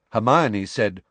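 plain reading voice

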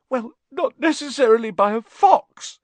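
high, loud, weepy protest; tearful. A narrator's reading. Lavender looking up at Hermione with streaming eyes, tearful. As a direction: wail a defensive reply. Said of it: "with streaming eyes".

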